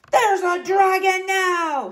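high, slow loud excited shout. A narrator's reading. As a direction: shout out the danger. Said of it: "shouted Sir Horace".

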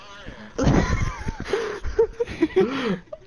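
high burst of laughter. Real, not acted. Laughing at the cockroach joke.

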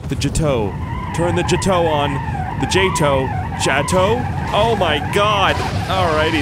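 loud, high, thrilled shouts; excited shouting. Real, not acted. Firing the rocket booster.

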